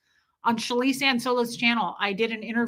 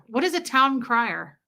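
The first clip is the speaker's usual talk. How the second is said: ordinary talk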